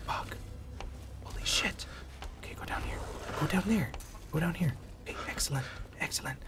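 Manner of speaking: hushed sneaking whisper. Real, not acted. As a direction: whisper so the monster won't hear. Sneaking past the monster.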